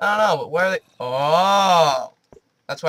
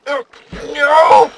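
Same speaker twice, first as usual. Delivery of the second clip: scared shout; 11 semitones higher, 11 dB louder than usual. Real, not acted. Sudden scare.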